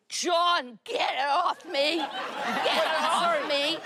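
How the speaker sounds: high, half-laughing mock yell; laughing through a retold scream. Wife retells screaming at husband, mock yell half-laughed. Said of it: "'cause I'm screaming".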